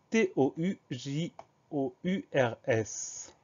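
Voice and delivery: letters dictated slowly with gaps; slow spelling. Shown as a demonstration. Teacher recapping the spelling for learners, slow spelling.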